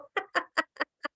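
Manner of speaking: high laugh falling off softly; trailing laugh. Shown as a demonstration. Teacher laughing freely during the exercise, easy. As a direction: let the laugh fade out. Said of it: "Just let it go".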